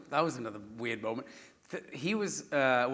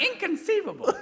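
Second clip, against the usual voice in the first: put-on high dramatic kid line; high, laughing kid mimic; imitating child; 4 dB louder than usual. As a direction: overact like a child. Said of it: "saying things like".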